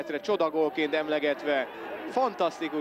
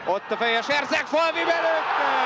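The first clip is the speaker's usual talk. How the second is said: high, loud, slow goal cry; goal shout; 9 semitones higher, 5 dB louder, slower than usual. Real, not acted. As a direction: stretch out the goal call. Goal call on a header.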